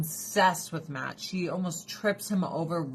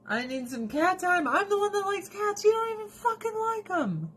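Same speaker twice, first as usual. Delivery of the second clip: much higher, exasperated rant; high mock rant. Real, not acted. Wife ranting that husband doesn't even like cats. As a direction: pitch it up and rant. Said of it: "ranting at husband".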